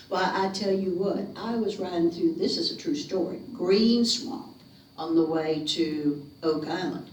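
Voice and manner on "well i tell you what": plain reading voice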